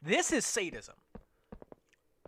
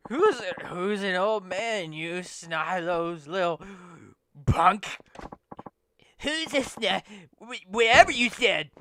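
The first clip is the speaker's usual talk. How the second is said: put-on cranky old man yelling back; angry old man voice; 4 semitones higher, 7 dB louder, slower than usual. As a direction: snap back like a cranky grandpa. Voicing old man retorting.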